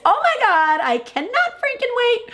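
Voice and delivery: very high, giddy squeal; excited fangirl. Real, not acted. Fan who cannot wait for the show, squealing. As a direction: squeal it, bursting with anticipation.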